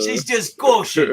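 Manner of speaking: loud, high-pitched Cockney; mock sexy Cockney. Painter keeps joking about runny paint, mock Cockney. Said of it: "sexy talk from a Cockney".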